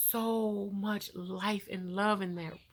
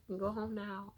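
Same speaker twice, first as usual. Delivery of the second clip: hushed news; 8 dB quieter than usual. Retold or imitated. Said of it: "They're like".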